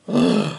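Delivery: sharp dramatic gasp. Real, not acted. Reader hearing of the beheading, gasping. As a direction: gasp in alarm. Head to be cut off.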